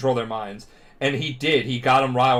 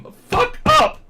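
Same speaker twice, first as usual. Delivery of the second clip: loud mock-heroic outburst; hammed-up shout; 8 dB louder, slower than usual. Friend vowing to rev up his carbines, hammed-up shout. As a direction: build to a pretend yell. Acted tearful hype between friends.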